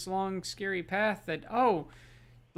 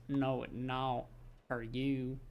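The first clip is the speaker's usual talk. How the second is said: slow, low, lazy southern drawl; 5 semitones lower, 7 dB quieter, slower than usual. Pretend. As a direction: lean into a lazy southern drawl like a hungry dinosaur. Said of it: "speaking with a southern drawl".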